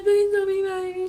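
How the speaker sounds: high-pitched tearful plea; mock bawling. Daughter on the phone to mom, imitated crying. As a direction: replay a dramatic whimpering plea. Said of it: "it was a little dramatic".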